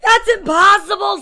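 loud, high protest; indignant kid. A narrator's reading. Boy answering his mom's scolding, loud indignant protest. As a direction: cry it out in disbelief.

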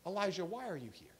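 quick, softly voiced question; soft divine question. God questioning Elijah in the cave, soft voice. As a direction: ask it gently, well below full voice. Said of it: "God comes down to Elijah, and he says".

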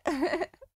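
high short giggle; laugh. Real, not acted. Player owning up to terrible shooting, giggling. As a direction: giggle at your own mistake.

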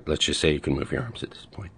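very soft, half-whispered aside; hushed aside. Acted. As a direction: slip it in under your breath. Quick aside within the meditation.

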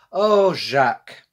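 put-on slow, drawn-out loud "Oh" from an old granny; a granny sighing over her grandson; loving granny voice. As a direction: call out fondly like a French grandmother. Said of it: "in French, of course".

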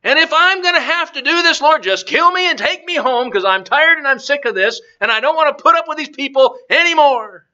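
whiny, high-pitched complaining, mock tearful; Moses. Fed-up leader begs the Lord to take him, whiny complaining. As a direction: moan it like you are fed up and can't go on. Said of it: "and he's crying".

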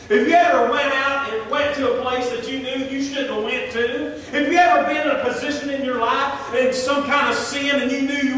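loud, high pleading preaching; full-force preaching. Real, not acted. Preacher challenges church about hidden sin, loud and urgent. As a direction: press the question on the congregation.

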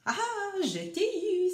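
high triumphant gotcha; playful gloating cry; exclaiming. A narrator's reading. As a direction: crow it high on catching the prey. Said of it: "s'écria-t-il".

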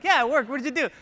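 mock-astonished, bright burst; surprised relief. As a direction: play the amazed user when it suddenly works. Acting relieved, surprised cutover user.

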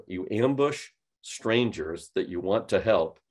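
ordinary delivery

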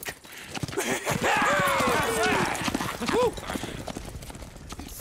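mocking laugh. Gang leaves mocking.

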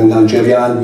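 normal talking voice

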